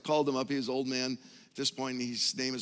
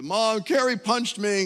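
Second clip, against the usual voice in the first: whiny little brother; 7 semitones higher, 9 dB louder, slower than usual. Retold or imitated. Retelling complaining to mom as child.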